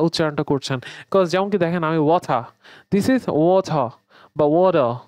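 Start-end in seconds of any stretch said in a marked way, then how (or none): 2.0-2.4 British ওয়াটার with airy t, no final r; British accent. Shown as a demonstration. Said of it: "British accent". 2.9-3.9 British water with crisp t, no final r; British accent. Shown as a demonstration. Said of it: "British accent". 4.4-4.9 American accent. Shown as a demonstration. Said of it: "American অ্যাকসেন্টে".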